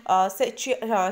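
usual speaking voice

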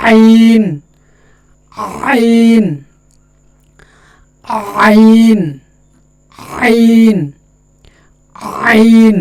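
আইন called out high and loud from the throat; clear recitation. Shown as a demonstration. Teacher reciting আইন loudly for students to repeat. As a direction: project আইন from mid-throat. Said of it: "দেখি একটু উচ্চারণ করি".

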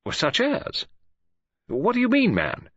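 high, sharp and defensive; alarmed father bristling at the question. A narrator's reading. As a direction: snap back in alarm, voice jumping up.

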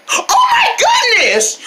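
high, drawn-out exclamation; acted shock. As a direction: gasp it out high and slow in dismay. Sister bursts in, shocked.

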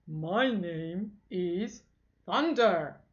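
strong Thunder voice. Acted. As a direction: play a powerful young hero stating his name. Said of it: "porque tiene mucha fuerza Thunder".

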